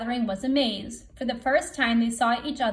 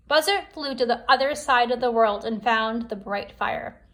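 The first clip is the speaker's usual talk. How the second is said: ordinary delivery, nothing marked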